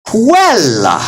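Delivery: "Quella" with the first syllable pitched high, then falling. Shown as a demonstration. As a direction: lift the voice sharply on "Quel" in "Quella". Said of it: "you can raise your voice there".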